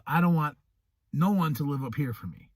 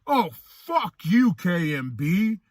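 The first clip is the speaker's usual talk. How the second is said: hammed-up angry fan, slow and louder; imitating angry hater; 4 semitones higher, 4 dB louder than usual. Imitating an angry fan who demands his Juggalo card, mock rage. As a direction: throw the hater's insults back mockingly. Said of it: "they're like".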